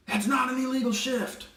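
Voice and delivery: high, nagging coach impression; a coach pestering the ref, hammed up high; mock whiny coach. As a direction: whine it like an outraged coach. Mocking coach demanding a flag.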